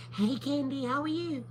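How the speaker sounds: put-on high, soft sweet-old-mom voice; imitating my mama. Shown as a demonstration. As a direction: gently imitate mom calling to check in. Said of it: "talk like my mama".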